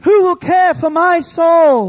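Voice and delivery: high, drawn-out full shout; shouted cry from the soul. Acted. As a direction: yell it slowly as a desperate plea. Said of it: "That's what they're crying out".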